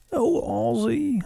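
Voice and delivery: high, slow theatrical villain. Acted. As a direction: swoon the name high and slow. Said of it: "try to do that justice".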